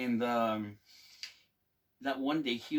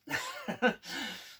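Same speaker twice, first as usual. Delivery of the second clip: breathy giggle; 8 semitones higher than usual. Real, not acted. Laughing at own retelling.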